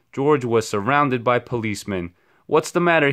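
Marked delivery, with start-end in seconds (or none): 2.5-3.1 nervous. A narrator's reading. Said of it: "he asked nervously".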